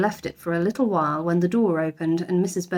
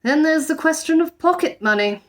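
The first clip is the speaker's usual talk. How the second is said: higher, wavering father voice; Mr Brown weakening; 8 semitones higher than usual. A narrator's reading. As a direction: give ground reluctantly.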